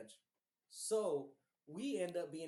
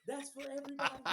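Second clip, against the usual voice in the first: high, mocking yell at rival fans; high taunting stadium yell; 9 semitones higher than usual. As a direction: jeer at the whole section. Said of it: "I'm yelling".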